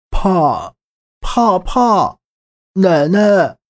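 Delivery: put-on scared child. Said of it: "躲在她身后面喃喃道".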